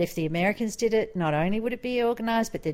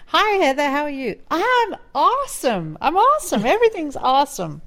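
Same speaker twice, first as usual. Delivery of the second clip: much higher, loud, gushing; perky American neighbour; 9 semitones higher, 5 dB louder than usual. Retold or imitated. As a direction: beam out relentless positivity.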